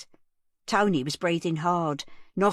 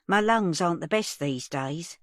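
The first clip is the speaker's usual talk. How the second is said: usual speaking voice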